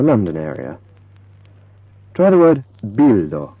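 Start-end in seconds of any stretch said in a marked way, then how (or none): 2.1-3.5 bildo with a clear, light L. Shown as a demonstration. Teacher showing learners a clear Esperanto L. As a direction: say bildo with a clean clear L, not dark. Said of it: "a nice clear L".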